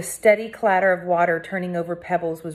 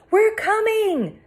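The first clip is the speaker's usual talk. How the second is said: high loud answer from afar; calling back; 4 dB louder, much slower than usual. A narrator's reading. Rescuer shouting back. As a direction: reassure them they're coming. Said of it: "a different voice".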